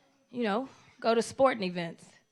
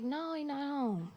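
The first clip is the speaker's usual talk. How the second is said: quiet answer; 8 dB quieter, slower than usual. Retold or imitated. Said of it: "I was like".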